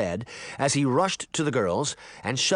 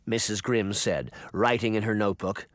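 usual speaking voice

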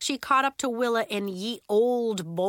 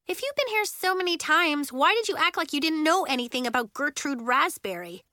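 put-on pitched-up, indignant child's challenge; high-voiced accusation; accusing; 7 semitones higher than usual. A narrator's reading. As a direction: demand an explanation from your friend. Child's accusing question in quarrel.

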